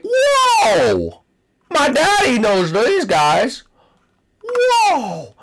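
high drawn-out whoa; wide-eyed kid's whoa; amazed whoa. Acted. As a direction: gawp in wonder at the arcade. Amazed at arcade.

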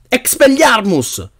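high, drawn-out incantation; exclaimed spell. A narrator's reading. Harry casts while held by the spider, exclaiming. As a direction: stretch the spell in a high cry. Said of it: "levò la bacchetta ed esclamò".

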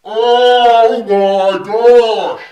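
long stretched loud cry; slow drawn-out wail; drawn-out shout. Real, not acted. Spice hits, drawn-out cry.

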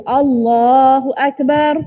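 Allahu Akbar said slow and even, Akbar not stretched; correct takbir. Shown as a demonstration. Teacher demonstrates the correct takbir to women. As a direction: model the proper Allahu Akbar for the class. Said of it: "nak kena cakap betul-betul".

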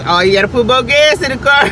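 nagging herself loudly about gas; mock shout. Talking to herself about needing gas, mock shouting.

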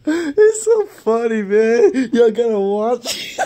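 high, loud and slow through laughter; real tearful laughter; laughing to tears. Real, not acted. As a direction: wheeze it out while crying laughing. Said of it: "I'm crying".

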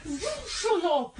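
high, loud shut up; angry yell. Real, not acted. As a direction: snap it loudly. Yelling at sibling.

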